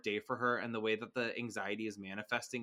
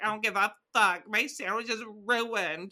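high, loud bratty whine, imitated; hammed-up outburst, drawn out at the end; mock tantrum; 9 semitones higher, 6 dB louder than usual. Host voicing a daughter who shoved her mom, mock furious.

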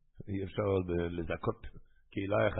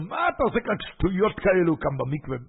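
mock yell; 8 semitones higher, 4 dB louder than usual. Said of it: "צעק אותו".

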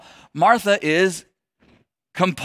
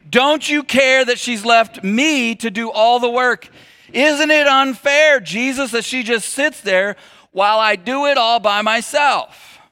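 higher, louder, slow aggrieved plea; aggrieved complaint; 5 semitones higher, 4 dB louder, slower than usual. Retold or imitated. Pastor voices Martha griping to Jesus, higher and slow. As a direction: press the unfairness.

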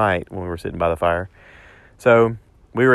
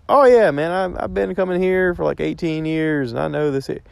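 mock boast; 6 semitones higher than usual. Buddy bragging he knows the lake.

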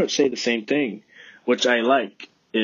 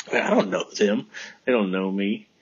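ordinary talk, nothing marked

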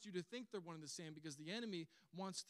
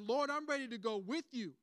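loud, higher, emphatic vow; bold declaration; 6 semitones higher, 6 dB louder than usual. Retold or imitated. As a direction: proclaim it boldly to the room. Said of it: "he made this bold declaration".

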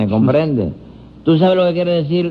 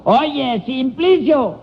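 high, stretched call; raised, carrying holler; shouting to the deaf; 8 semitones higher than usual. Acted. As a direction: call the question out to a deaf man. Testing the deaf man's hearing.

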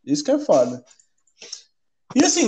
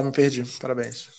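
self-mocking mutter; 6 semitones lower, 8 dB quieter than usual. Real, not acted. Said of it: "eu esqueci o que eu ia falar".

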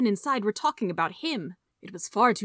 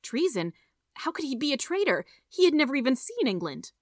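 high-pitched, incredulous protest; thunderstruck; 7 semitones higher than usual. A narrator's reading. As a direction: reel in disbelief at the charge. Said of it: "Charles was thunderstruck".

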